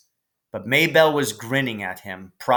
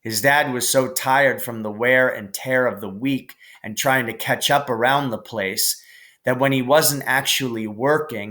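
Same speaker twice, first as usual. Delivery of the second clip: ordinary delivery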